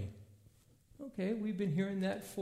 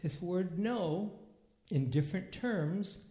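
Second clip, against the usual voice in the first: usual speaking voice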